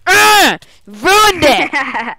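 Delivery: loud, high yell of frustration; exasperated outburst. Real, not acted. Game master interrupted mid-reading, exasperated yell. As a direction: explode at the interruption.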